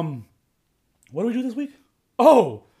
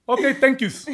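higher-pitched mimic of Miguel's English; lightly accented, pitched-up English imitation; imitating limited English; 6 semitones higher than usual. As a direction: play a friend with four words of English. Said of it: "He knows four words in English".

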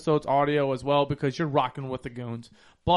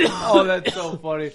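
ordinary delivery

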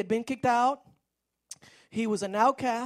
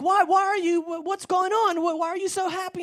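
puzzled parents; 8 semitones higher, 4 dB louder than usual. Acted.

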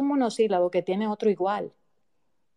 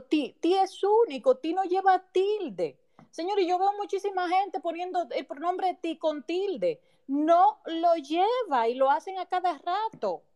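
exasperated; 10 semitones higher than usual. Real, not acted. Exasperated about grammar errors.

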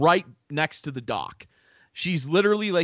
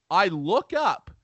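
normal talking voice

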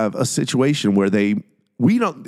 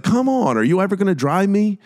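higher, quick whiny pleading; mock impatient guest; 10 semitones higher, faster than usual. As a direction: whine at him to finally drive you. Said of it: "And the dude's like".

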